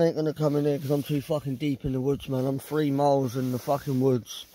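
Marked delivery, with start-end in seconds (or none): none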